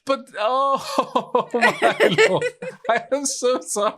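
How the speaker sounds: high, squeaky-with-laughter apology; laughing apology. Real, not acted. Builder apologises to the trapped friend, helplessly laughing. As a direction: gasp it out while cracking up.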